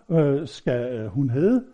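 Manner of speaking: ordinary talk, nothing marked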